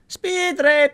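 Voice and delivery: high, loud test call; shouting. Real, not acted. Player testing the cave for echo, high shout. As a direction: holler it to catch an echo. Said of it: "It sounds like you're shouting".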